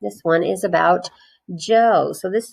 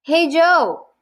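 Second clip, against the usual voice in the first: put-on other kid calling; 9 semitones higher, 3 dB louder than usual. A narrator's reading. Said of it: "asked John".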